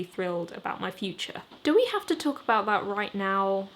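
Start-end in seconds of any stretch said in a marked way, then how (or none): none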